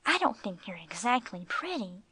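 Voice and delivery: ordinary delivery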